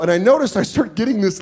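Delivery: ordinary talk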